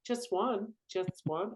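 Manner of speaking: high, soft mock begging; pleading girlfriend. Girlfriend begging, voiced in story.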